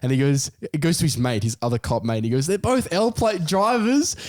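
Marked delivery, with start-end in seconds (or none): 2.5-4.2 very high, slow mock cop; amused cop. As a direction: drawl it out to your partner. Cop tells partner, amused.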